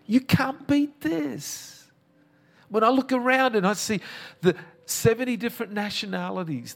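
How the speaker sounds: high, ringing preaching voice rising to a climax; fervent pastor's climax, pitched high. Acted. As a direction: lift it up and proclaim it to the whole house. Preaching at full force.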